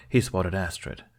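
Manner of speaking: ordinary delivery, nothing marked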